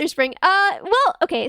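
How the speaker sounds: ordinary delivery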